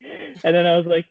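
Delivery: ordinary delivery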